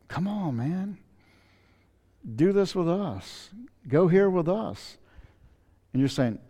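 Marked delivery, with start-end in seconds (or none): 0.1-5.0 coaxing friends. Retold or imitated. Friends tempting a believer.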